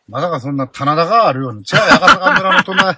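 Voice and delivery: giggling joke about terraced paddies; laughing. Real, not acted. Joking to cohost about rural Osaka, laughing.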